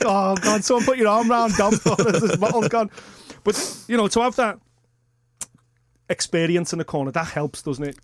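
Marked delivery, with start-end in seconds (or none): 0.0-2.9 louder, higher, laughing through it, slowing at the end; a fan at home half laughing, half worried; hammed-up worry, laughing it out; laughing mock alarm. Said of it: "And I'm like".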